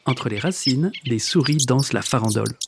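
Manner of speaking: plain reading voice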